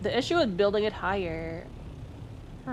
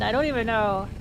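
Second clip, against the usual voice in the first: ordinary delivery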